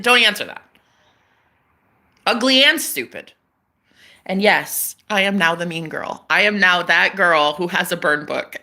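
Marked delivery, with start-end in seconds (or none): none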